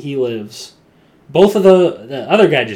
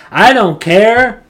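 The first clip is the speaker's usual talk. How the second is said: coward's whine; 10 semitones higher, 11 dB louder, slower than usual. Retold or imitated. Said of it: "He's just like".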